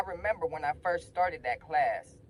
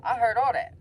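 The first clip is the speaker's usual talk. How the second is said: high, loud blurt; excited admission; 8 semitones higher, 9 dB louder than usual. Retold or imitated. Student telling the principal he overheard, excited. Eager confession of eavesdropping to principal.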